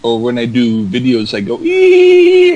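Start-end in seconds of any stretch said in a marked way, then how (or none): 1.6-2.5 high squeaky puppet squeal; tiny puppet squeal. Shown as a demonstration. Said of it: "I do a puppet voice".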